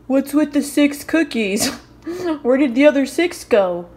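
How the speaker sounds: loud mock complaint; Daryl. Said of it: "Daryl's gonna be like".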